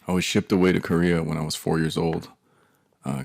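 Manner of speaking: ordinary talk, nothing marked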